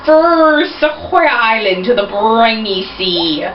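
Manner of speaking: put-on lightly done British pirate accent, slow and drawn out; British-sounding pirate voice. Pirate captain greets Golly at the dock, in a faint British pirate accent. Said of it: "my pirate kind of sounds like he's British".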